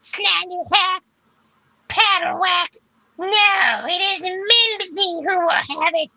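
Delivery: very high, loud falsetto creature; high-pitched echoing she-creature call; female voice. Acted. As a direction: put on a piercing, slow female monster voice. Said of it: "You hear a female voice say".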